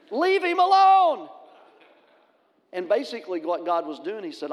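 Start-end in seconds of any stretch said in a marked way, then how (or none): 0.1-1.3 slow, loud, high commanding divine voice; Holy Spirit's voice. Retold or imitated. Holy Spirit commands the pastor to leave the boy alone. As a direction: boom it out slowly like heaven speaking. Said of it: "the Holy Spirit's going".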